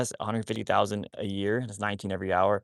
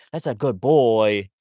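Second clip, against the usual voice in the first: bright, louder praise for a good dog; 7 dB louder than usual. Real, not acted. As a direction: gush over the dog for coming back.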